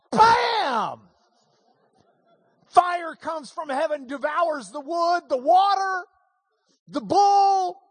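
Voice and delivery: high, loud, building storytelling; thrilled telling of the miracle; excited climax. A narrator's reading. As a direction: let the fire crash down.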